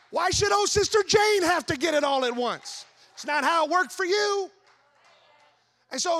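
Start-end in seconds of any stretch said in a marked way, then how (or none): 0.1-4.5 loud, higher-pitched challenge to the congregation; forceful preaching. Real, not acted. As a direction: press the question home at full preaching volume.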